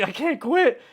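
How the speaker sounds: retold cry of "I can't quit", pushed up and out; half-hearted tearful "I can't quit". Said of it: "I'm crying".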